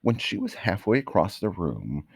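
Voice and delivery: plain reading voice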